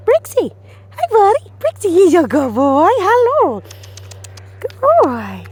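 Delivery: high, loud sing-song greeting to the dog; high dog praise. Real, not acted. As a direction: coax the dog to look up. Calling dog's attention with praise.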